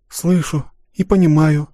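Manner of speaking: usual speaking voice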